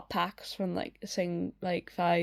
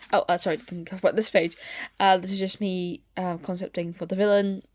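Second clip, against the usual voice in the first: ordinary talk, nothing marked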